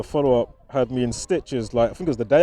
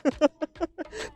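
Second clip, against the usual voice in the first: high giggle; 13 semitones higher, 6 dB quieter than usual. Real, not acted. Amused at the timing.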